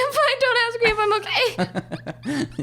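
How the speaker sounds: high, slow voice cracking into laughing tears; hammed-up fighting back tears; mock breaking down. Server answering nosy coworker, mock tearful and high. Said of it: "Karens at Cactus Club".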